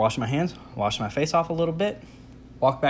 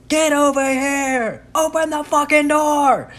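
much higher, louder, slow desperate shout; frantic yell; 7 dB louder, slower than usual. Retold or imitated. Yelling at friends to open the jammed door, frantic shout. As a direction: bellow it at your friends across the room. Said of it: "I'm yelling at Tracy and Allison".

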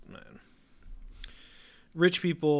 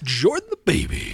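big booming announcer; 7 semitones higher, 11 dB louder than usual. Acted. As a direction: announce the name like a ring intro. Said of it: "you really got into that one".

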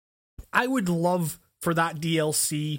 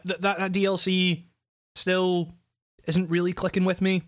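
normal talking voice

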